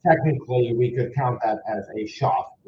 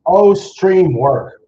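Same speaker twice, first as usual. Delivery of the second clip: loud, higher, drawn-out plea; exasperated plea; 8 semitones higher, 11 dB louder, much slower than usual. Real, not acted. Streamer to a failing stream, loud exasperated plea. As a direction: plead loudly and slowly with a broken stream.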